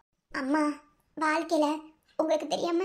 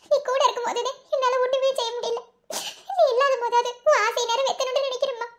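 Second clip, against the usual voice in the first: tearful plea; 7 semitones higher than usual. Acted. Daughter pleads with dead mother.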